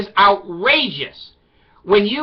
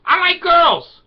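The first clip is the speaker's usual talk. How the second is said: put-on excited kid; 9 semitones higher, 4 dB louder, slower than usual. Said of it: "I go".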